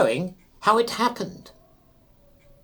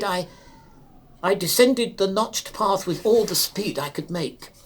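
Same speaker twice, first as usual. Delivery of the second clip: ordinary delivery, nothing marked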